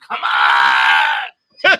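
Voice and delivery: loud, frustrated driver's shout; scream. Retold or imitated. Driver stuck behind others at a green light, scream. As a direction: yell it out with the last word stretched. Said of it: "and you just scream".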